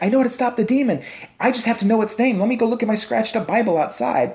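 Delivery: high, hammed-up woman's voice; mock Lorraine Warren. Lorraine coming in to stop the demon, high mocking voice. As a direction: announce it in a high mock-earnest voice.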